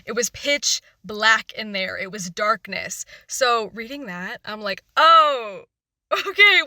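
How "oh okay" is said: loud surprised gasp of a word; startled exclamation. Retold or imitated. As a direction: blurt it out, shocked. Acted shocked reaction while reading.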